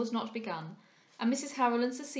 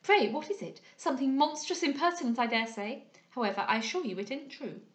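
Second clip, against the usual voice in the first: high, louder start, settling as she goes; prattling young lady, indignant; 4 semitones higher, 4 dB louder than usual. Acted. Young lady answering a strange report about her, indignant. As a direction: play a chatty society lady protesting. Voices Miss Larolles.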